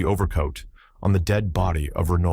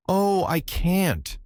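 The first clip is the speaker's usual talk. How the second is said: high, drawn-out wail at usual volume; anguished cry; 12 semitones higher, much slower than usual. A narrator's reading. Broken-down girl cries out to the man who loves her, anguished cry. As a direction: wail it slowly, much higher than usual. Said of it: "she cried".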